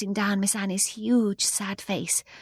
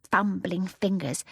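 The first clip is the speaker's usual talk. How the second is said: plain reading voice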